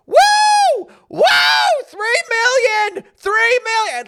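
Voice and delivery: very high, loud whooping cheer; screaming. Retold or imitated. Kids cheering in a parking lot at night, screaming wildly. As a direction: imitate kids shrieking with excitement. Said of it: "They're screaming".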